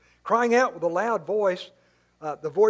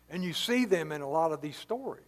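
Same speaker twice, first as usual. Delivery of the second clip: usual speaking voice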